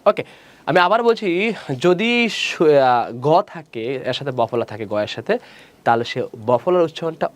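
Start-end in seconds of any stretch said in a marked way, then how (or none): none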